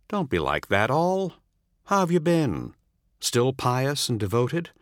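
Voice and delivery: a hint of a smug visitor; coaxing old comrade. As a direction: needle him with easy charm. Narrator voices the visitor John.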